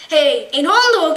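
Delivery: loud, delighted cry; excited discovery. Acted. Girl spotting a doghouse, excited cry. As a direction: point it out with delight.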